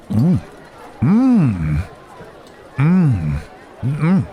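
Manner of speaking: high, drawn-out "mm" of relish; appreciative hums while tasting; savouring hums. Acted.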